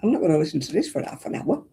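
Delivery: talking while crunching chips; words round a mouthful of crisps. Real, not acted. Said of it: "I'm sorry if I'm crunching".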